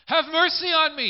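high, loud pleading call; desperate beggar calling; beggar's cry. Acted. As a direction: cry out for mercy. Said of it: "it would have been".

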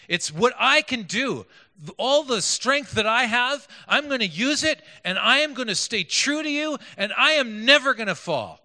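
high, loud, determined vow; self-reliant insistence. Retold or imitated. Preacher voicing Peter relying on his own strength, insistent. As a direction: swear it with heavy emphasis, each claim driven home. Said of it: "it's all about him".

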